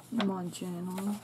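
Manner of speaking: usual speaking voice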